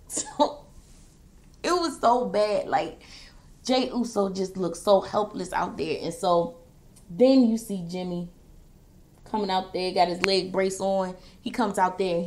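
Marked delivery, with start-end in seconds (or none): none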